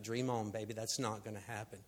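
low, quiet, dismissive aside; dismissive host. Retold or imitated. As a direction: play the host brushing him off under his breath. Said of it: "That guy's like".

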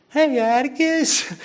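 put-on high, slow child's call across the crowd; little girl calling out. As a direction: call out brightly like a small girl. Child Scout calls to her father.

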